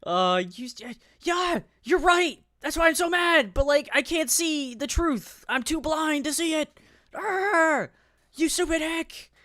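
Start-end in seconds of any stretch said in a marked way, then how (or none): 1.2-9.3 hammed-up high, loud tantrum; mock outraged meltdown. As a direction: mimic a furious rant in a piercingly high voice. Mockingly acting out the furious woman.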